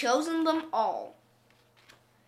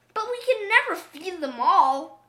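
put-on higher, louder worried old woman; old woman protesting; 5 semitones higher, 5 dB louder than usual. A narrator's reading. Old woman objects to keeping all cats, high protest. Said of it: "said the old woman".

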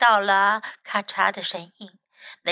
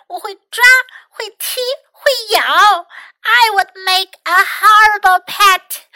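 loud, high-pitched indignant protest; big yelling voice; 9 semitones higher, 8 dB louder than usual. A narrator's reading. Trapped mouse refusing to be a pet, yelling. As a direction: yell it big, far up in pitch. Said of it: "yelled in a big, big voice".

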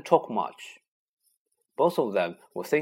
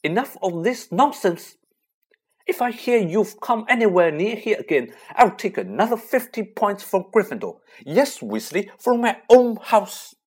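much higher and louder, furious scolding; lost her temper; 10 semitones higher, 7 dB louder than usual. A narrator's reading. Teacher threatening the boys with lost points: furious scolding. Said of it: "she lost her temper".

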